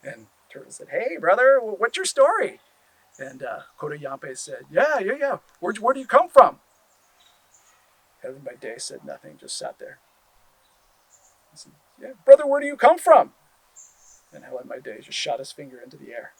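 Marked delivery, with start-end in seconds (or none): 0.9-2.6 high, loud and friendly call; eager greeting. Acted. Turtle calling out to the stranger on the raft, excited. 4.7-6.6 high, loud and keen; eager question. Acted. Kodo Yampe asking the stranger where he comes from, eager. 12.0-13.3 high, loud and insistent; loud eager question. Acted. Turtle asking the silent stranger again, loud and insistent.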